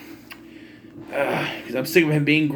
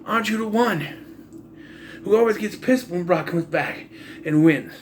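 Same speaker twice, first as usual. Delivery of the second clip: higher-pitched, indignant mock challenge; imitated viewer; 7 semitones higher than usual. Viewer challenging the host about his old outburst, imitated indignation. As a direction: question the host in an accusing, raised pitch. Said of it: "you're saying".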